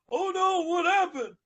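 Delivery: high, loud panicked cry; dragon yells. Acted. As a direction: yelp in alarm in the dark. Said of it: "the purple dragon yelled".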